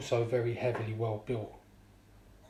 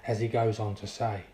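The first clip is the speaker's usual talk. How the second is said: usual speaking voice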